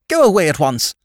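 high, loud dismissal; indignant lady's sharp command; furious. A narrator's reading. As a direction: order the servant out in outrage. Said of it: "erect and furious".

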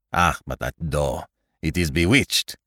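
put-on accented, low irritation; understated anger; angry. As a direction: grumble crossly in a foreign accent. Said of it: "cried Poirot angrily at last".

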